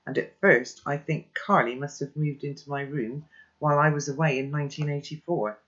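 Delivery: ordinary delivery, nothing marked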